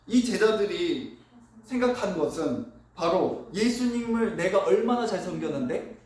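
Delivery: ordinary delivery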